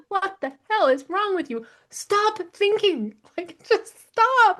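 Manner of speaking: high, drawn-out exasperated plea; mock self-scolding. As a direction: scold yourself, stretching the words high and slow. Retelling scolding herself in the chair.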